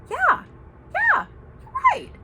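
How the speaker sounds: very high, loud mock agreement; hammed-up high-pitched concession; imitated friend agreeing. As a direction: squeak out the friend's yeah. Voices friend giving in.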